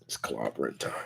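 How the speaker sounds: very low, hushed catchphrase; quiet, deep tough-guy aside; Ben Grimm. Acted. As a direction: murmur it deep, as a motto. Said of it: "That is a great motto for Ben".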